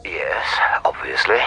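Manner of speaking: a low, dry whisper. Acted. Forensic expert answering the detective, whispered. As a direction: breathe the answer out.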